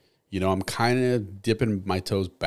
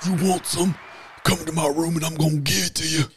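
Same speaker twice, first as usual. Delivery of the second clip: wrestler promo voice; 9 semitones higher, 3 dB louder than usual. Pretend. Trash talk in wrestler voice.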